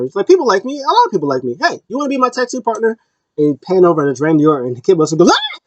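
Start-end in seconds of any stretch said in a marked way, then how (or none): 0.2-3.0 high-pitched mock protest, swooping up; defensive retort. Acting out Kevin Owens' defensive retort. 5.3-5.6 high-pitched mock scream of fright; startled yelp. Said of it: "He got scared".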